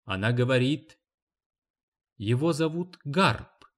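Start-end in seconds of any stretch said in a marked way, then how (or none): none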